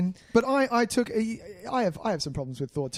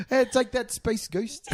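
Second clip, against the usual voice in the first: Korg voice; 7 semitones higher, slower than usual. Retold or imitated. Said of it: "it really goes".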